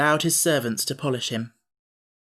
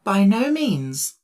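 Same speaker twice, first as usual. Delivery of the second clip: slow, stately, higher denial; with dignity; 5 semitones higher, 4 dB louder, much slower than usual. Acted. Emperor correcting the Pumpkinhead, dignified. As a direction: draw yourself up proudly. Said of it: "responded the Emperor with dignity".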